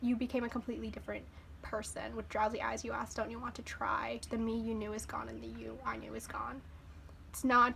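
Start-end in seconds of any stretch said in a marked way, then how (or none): 0.5-6.6 soft voiceless reading of the verse; whispered lyrics. Acted. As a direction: whisper the words like a secret confession.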